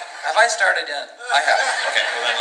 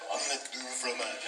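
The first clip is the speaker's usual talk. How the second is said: muttered practice; 16 dB quieter than usual. Acted. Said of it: "I'm practicing all the time".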